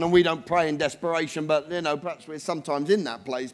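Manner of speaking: ordinary delivery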